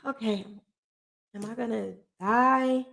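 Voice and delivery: soft, tearful sigh of a question; imitated crying. Woman recalls lying in bed fearing death, quietly tearful. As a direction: act out crying quietly, hushed and scared. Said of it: "like, crying".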